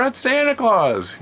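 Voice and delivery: high, loud, drawn-out shout; excited announcement. Retold or imitated. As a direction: holler it like kids hyping Santa. Older kids announce Santa's arrival, retold.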